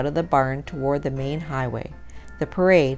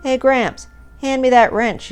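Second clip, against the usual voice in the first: raised-voice shout to get gramps' attention; yelling; 8 semitones higher, 6 dB louder than usual. A narrator's reading. Ben to Gramps while mounting a stove on the float, yelling. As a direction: call out loudly, pitched up. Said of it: "yelled Ben".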